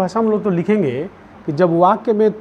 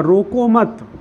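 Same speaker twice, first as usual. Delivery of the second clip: "रोको मत" run together, higher, then a stop; pause after मत; 6 semitones higher, 3 dB louder, much slower than usual. Shown as a demonstration. Teacher showing the moved comma, "रोको मत" as one emphatic unit. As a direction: join "मत" onto "रोको" and break after it. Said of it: "comma का प्रयोग बदल दीजिए".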